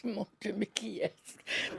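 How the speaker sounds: quiet slow dismay under her breath; panicked inner whisper. Retold or imitated. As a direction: hush it like a private panic. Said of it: "Je dis".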